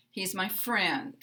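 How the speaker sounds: stressed "friend" with a downward glide; unstressed "He's my", falling "friend"; falling intonation. Shown as a demonstration. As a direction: lift "friend" slightly, then glide it down. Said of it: "we will have a slight rise".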